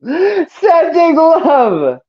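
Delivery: high, very slow singsong; drawn-out singsong shout-out. Real, not acted. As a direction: stretch every word up high. Shout-out to a listener.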